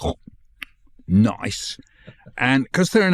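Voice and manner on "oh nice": low, drawn-out "nice" with a long i; the famous nice. Retold or imitated. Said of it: "of you just going".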